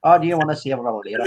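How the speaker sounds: quick put-on Northern Irish; Northern Irish accent. Finishing the playful threat to Sven, Northern Irish accent. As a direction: rattle it off in mock Belfast. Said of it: "in your Northern Irish".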